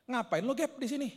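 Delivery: high-pitched surprised friend, imitated; kaget. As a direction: act out bumping into a friend unexpectedly. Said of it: "Temannya kaget". (menirukan teman yang kaget, suara tinggi)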